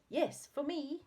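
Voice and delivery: ordinary delivery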